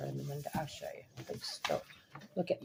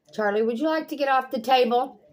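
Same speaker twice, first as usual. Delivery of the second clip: higher, louder sarcastic call to the cat; 9 semitones higher, 9 dB louder than usual. Real, not acted. Owner scolding her cat on the table, sarcastic loud call. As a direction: raise your voice at the pet across the room.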